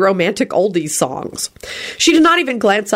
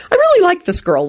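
cheerful; 13 semitones higher than usual. A narrator's reading. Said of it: "Lupin thought cheerfully".

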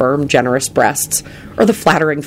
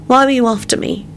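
sudden question; 10 semitones higher than usual. A narrator's reading. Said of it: "she said suddenly".

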